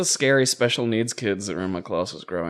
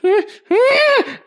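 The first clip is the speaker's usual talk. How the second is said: high, loud mock shrieks; imitated attack cry; 7 dB louder than usual. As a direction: act out the kid snapping with wordless squeals. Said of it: "and went".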